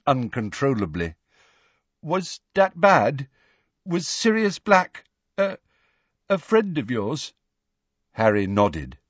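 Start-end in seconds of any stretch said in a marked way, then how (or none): 2.0-7.3 put-on timid boy voice. A narrator's reading. Neville asking gently.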